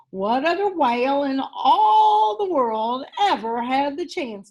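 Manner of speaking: honoured whale welcoming the mouse aboard; lightly voiced eager whale. Boris answers Amos.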